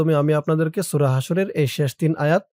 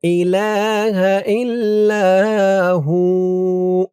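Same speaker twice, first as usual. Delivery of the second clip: tajweed recitation; 3 semitones higher, 6 dB louder, much slower than usual. Shown as a demonstration. Teacher showing where to stretch each sound. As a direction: draw out ইলাহা and ইল্লা, stop on হু. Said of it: "খেয়াল করবেন কোথায় কেমন টান দেই".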